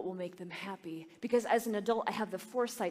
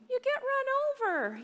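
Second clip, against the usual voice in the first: put-on high child's voice; sing-song child answer; kid voice; 13 semitones higher than usual. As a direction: recite it like a small kid.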